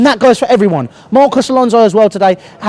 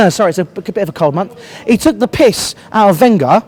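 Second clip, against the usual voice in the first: ordinary talk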